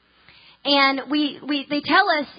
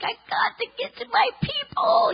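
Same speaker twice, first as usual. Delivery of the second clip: mock whine; 12 semitones higher than usual. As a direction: whine it like a frustrated child. Stuck on a five-hour layover.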